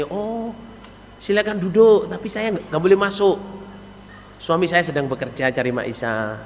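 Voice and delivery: soft, gracious welcome; acted courteous wife, hushed and pleasant; polite wife. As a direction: gently offer a seat while excusing yourself. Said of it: "Bahasanya sopan, enak, Saudara".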